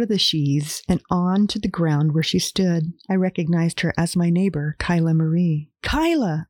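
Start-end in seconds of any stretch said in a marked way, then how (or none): 5.8-6.5 high calling out; yelling a name. Acted. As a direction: call her name across the road. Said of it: "I yelled".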